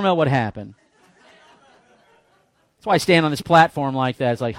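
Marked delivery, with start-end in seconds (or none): none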